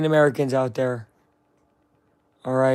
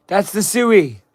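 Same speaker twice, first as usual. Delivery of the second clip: loud, high hype call; hyped shout of the Sui celebration; 7 semitones higher, 10 dB louder, much slower than usual. Acted. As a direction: yell it like a goal celebration.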